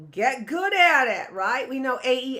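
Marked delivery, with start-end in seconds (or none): none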